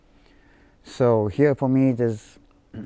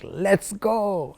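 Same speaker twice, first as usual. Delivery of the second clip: high, bright-pitched "Let's go" with a falling finish; spring out of bed raring to go; eager morning cheer; 9 semitones higher than usual. Retold or imitated. Man greeting each new morning, eager and excited. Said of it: "I wake up in the morning saying".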